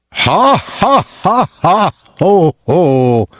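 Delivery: high, odd-sounding scornful royal laugh; king's mocking laugh. King laughing at the poor father, high and scornful. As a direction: laugh him off like a cruel king.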